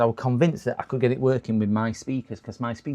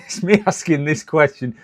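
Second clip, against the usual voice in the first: laughing; 4 semitones higher, 6 dB louder than usual. Real, not acted. Laughing at own question.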